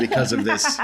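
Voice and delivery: words breaking into a laugh. Real, not acted. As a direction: laugh through the end of the line. Laughing at his own joke.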